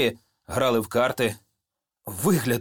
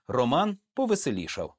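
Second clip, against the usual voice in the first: ordinary delivery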